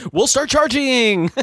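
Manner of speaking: high, loud, drawn-out pitchman threat; mock ad announcer. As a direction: holler it slowly like a hammed-up commercial.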